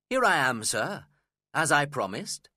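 the guest greets Heathcliff cheerfully. A narrator's reading. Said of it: "I said cheerfully".

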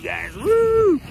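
usual speaking voice

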